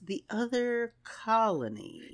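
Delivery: very slow, hushed suspense; dramatic reveal. Retold or imitated. Odell revealing another colony, slow dramatic reveal. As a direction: draw it out softly like a cliffhanger. Dramatic repeat of the cliffhanger line.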